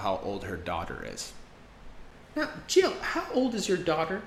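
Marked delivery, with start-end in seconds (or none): 2.4-4.2 much higher, chatty neighbor voice; friendly neighbor asking. Acted. Neighbor asking the mom how old her daughter is, chatty. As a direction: ask sweetly like a neighbor.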